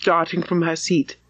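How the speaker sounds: ordinary delivery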